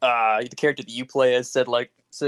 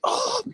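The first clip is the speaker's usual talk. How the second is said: very high-pitched "oh"; mock startled cry. Player imitates himself and Phil reacting, high mock cry. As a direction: squeal an oh in imitation.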